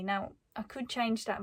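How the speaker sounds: usual speaking voice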